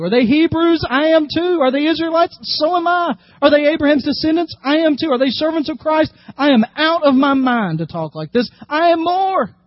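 high, rising, proud questions; emphatic scripture reading. Acted. Preacher reading Paul's boast to the church, high and emphatic. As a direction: read it as Paul's fierce boast.

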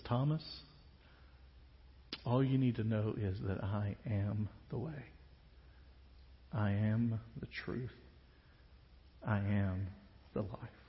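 Jesus answering Thomas, soft and low; profound declaration. Acted. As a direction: let each "I am" land gently. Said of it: "utters one of the most profound and important declarations".

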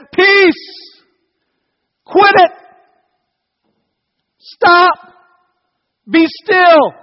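high, loud, sharp shouts; strong rebuke. Acted. Jesus waking up to rebuke the storm, shouting. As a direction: shout commands like a just-woken, fed-up parent. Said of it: "to strongly speak against".

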